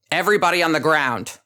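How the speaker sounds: barked police order through a loudhailer; harsh amplified order. A narrator's reading. Enforcer ordering the crowd to the ground, harsh amplified shout. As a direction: bark it like an enforcer's amplified command. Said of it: "The enforcer's voice is harsh, amplified".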